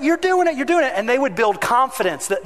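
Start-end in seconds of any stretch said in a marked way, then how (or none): 0.0-0.9 high, eager cheering; encouraging shout. Retold or imitated. Dad cheering his kid riding a bike, high and eager. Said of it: "I would start".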